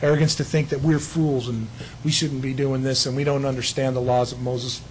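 normal talking voice

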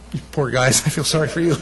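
usual speaking voice

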